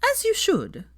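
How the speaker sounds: approving a friend's guilt-trip, firmly. A narrator's reading. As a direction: settle it firmly, in Sophia's high voice. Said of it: "said Sophia firmly".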